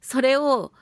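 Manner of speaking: ordinary delivery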